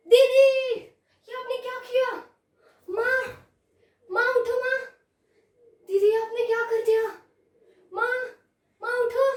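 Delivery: high, panicked cries; screaming in alarm. Acted. Younger sister finding mother down, screaming in alarm. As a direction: cry out high and urgent to wake her. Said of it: "जोर से चिल्लाने लग गई".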